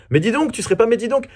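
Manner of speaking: rising, high MAIS DIS DONC; catchphrase call. Shown as a demonstration. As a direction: act out the catchphrase, voice jumping way up. Then acts out his catchphrase.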